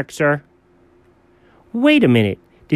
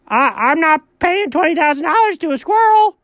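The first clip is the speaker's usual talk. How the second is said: high-pitched outraged protest; stammer, then refuse in a high voice; comic high outrage; flustered refusal; 11 semitones higher than usual. Acted. Flustered refusal to pay squirrel.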